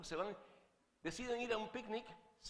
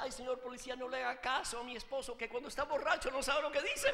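high, hammed-up nervous pleading; comic panicky wife; mock nervous wife; 6 semitones higher, 4 dB louder than usual. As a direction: build louder as if flustered before a policeman. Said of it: "más nerviosa todavía le dice al policía".

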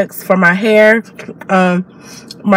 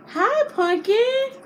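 high, slow, sing-song coo; 7 dB quieter, much slower than usual. Real, not acted. Mom greeting her sleepy baby, high slow coo.

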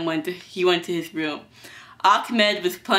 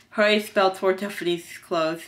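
ordinary delivery

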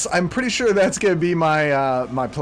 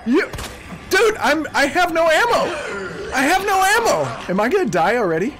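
high, loud panicked laughing yell; panicking gamer; 9 semitones higher, 3 dB louder than usual. Real, not acted. Out of ammo, enemy attacking.

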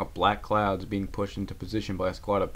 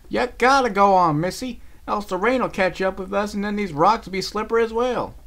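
higher, louder sharp insistence; angry; 12 semitones higher, 7 dB louder than usual. Acted. Applejack angrily pushes Rarity on. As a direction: snap her back into moving. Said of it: "flashed in anger".

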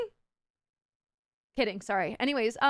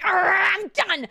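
grumpy; 6 semitones higher, 4 dB louder, much slower than usual. Retold or imitated. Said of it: "I wasn't that grumpy".

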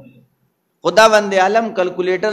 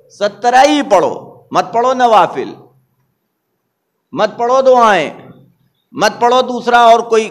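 rising, louder and higher preaching, building to a peak; forceful preaching; 5 semitones higher, 6 dB louder than usual. Acted. Preacher scolding the congregation about prayers, building to loud preaching. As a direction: drive the commands home to the congregation.

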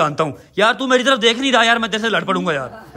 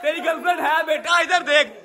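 much higher, gleeful teasing; excited teasing; 8 semitones higher than usual. Real, not acted. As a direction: tease him with delight, pitched up high. Teasing the boy over the girlfriend. (Girlfriend की बात पर लड़के को ऊँची आवाज़ में खुशी से छेड़ना)

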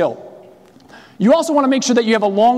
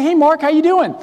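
cheery greeting; 6 semitones higher than usual. Retold or imitated. Cheery hello to Mark on the phone. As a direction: greet him all upbeat.

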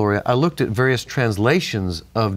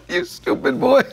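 higher mock scold, half laughing; mock angry yell; 13 semitones higher than usual. Grandfather scolding the boy who hit the garage, higher mock yell. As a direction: play the furious grandpa while amused. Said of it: "never got mad at me. Until that day".

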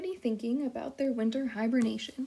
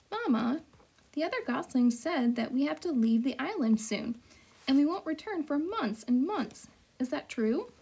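put-on a higher, small curious child's voice; young gosling; 3 semitones higher than usual. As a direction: ask Mama like a puzzled little gosling. Young gosling asks his mother.

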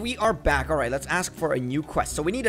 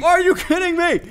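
even higher, loud strained wail of disbelief; strained outraged cry; 12 semitones higher, 7 dB louder than usual. Real, not acted. Player repeating the complaint to the game, higher strained wail. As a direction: let the voice crack into a whine of outrage. Fish escapes, repeats outburst.

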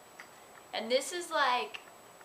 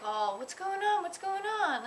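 friends asking; 8 semitones higher than usual. Retold or imitated. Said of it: "and they're like".

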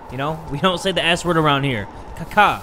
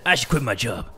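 lower-pitched voice for a grumbling street worker; a lightly voiced, lower weary worker; 8 semitones lower than usual. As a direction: grumble it like a fed-up sweeper. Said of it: "The street worker said".

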